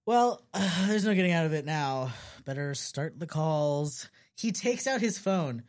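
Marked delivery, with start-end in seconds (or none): 0.0-4.1 sighing, reluctant acceptance; resigned sigh. Acted. Sans gives in to the party, sighing. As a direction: sigh and give in, slowing at the end. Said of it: "He sighs".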